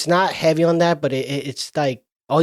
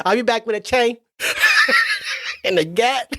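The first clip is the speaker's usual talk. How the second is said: laughing threat; 6 semitones higher than usual. Retold or imitated. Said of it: "He's like".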